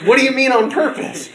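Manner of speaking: imitating students in a loud, high squawk; shocked outcry. Eighth graders catch the teacher's slip, loud incredulous outcry.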